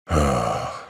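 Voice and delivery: low, weary sigh; heavy sigh. Acted. Sailor before admitting he hesitated, heavy sigh. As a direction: let out a long low sigh.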